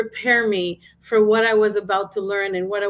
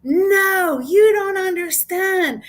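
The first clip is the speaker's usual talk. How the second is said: high, loud, crying yell, slowing on the last words; a sobbing woman's anguished shout; tearful yell; 9 semitones higher, 5 dB louder, much slower than usual. Retold or imitated. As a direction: cry it out in desperation. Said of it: "she yelled at me".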